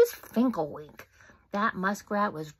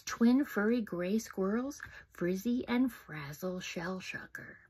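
plain reading voice

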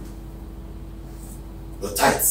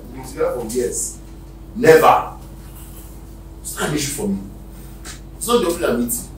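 ordinary talk

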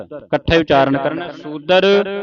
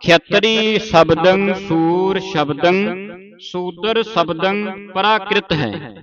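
the whole line slow and higher; slow full recitation; 4 semitones higher, slower than usual. Shown as a demonstration. Teacher reciting the complete verse for the class, slowly. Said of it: "ਹਾਂ".

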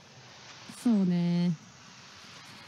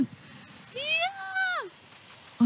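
long, thin, high "iyaa"; high drawn-out squeal; 11 dB quieter, much slower than usual. Real, not acted. Reacting to organisers skimming money, a soft high squeal. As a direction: squeal it softly and stretch it right out.

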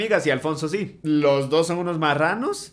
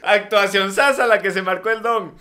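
laughing through the words; amused laughing comment; 4 semitones higher, 5 dB louder than usual. Real, not acted. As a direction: chuckle while praising. Laughing after clip.

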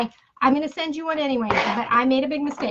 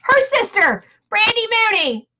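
high, loud reveal of the winner; big high-pitched prize reveal; excited winner announcement; 8 semitones higher, 4 dB louder than usual. Real, not acted.

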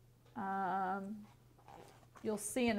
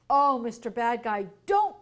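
crying out; 8 dB louder than usual. A narrator's reading. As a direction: cry out to stop him, peaking at the end. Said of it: "cried Bill".

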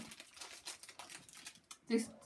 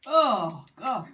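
loud; 11 dB louder than usual. Real, not acted. Reaction to sour candy.

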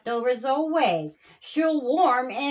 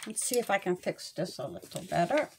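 quiet aside; 6 semitones lower, 10 dB quieter, much faster than usual. Real, not acted. Reader's aside, fixing something.

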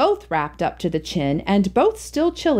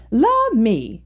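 put-on old aunt's exclamation; 4 semitones higher than usual. A narrator's reading. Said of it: "exclaimed Aunt Margaret".

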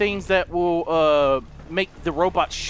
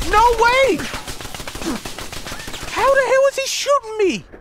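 outraged shout; 13 semitones higher than usual. Real, not acted. Gamer shouting at an unfair enemy. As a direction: yell it in real outrage. Being shot in game.

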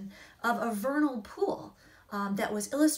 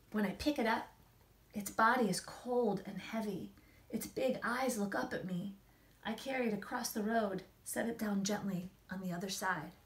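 gentle, hushed storybook reading; story narration. A narrator's reading. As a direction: read it like a bedtime picture book. Reads picture book aloud.

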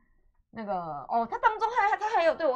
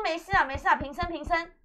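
mock royal pardon; 7 semitones higher, 5 dB louder than usual. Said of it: "那我说".

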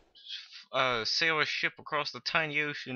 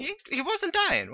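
very high squeaky disbelief. Real, not acted.

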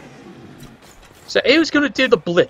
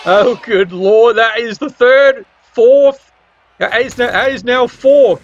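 excited shout; 4 semitones higher than usual. Real, not acted. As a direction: yell it out as the pile-up grows. Disaster piling up on pitch.

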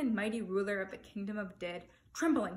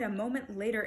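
plain reading voice

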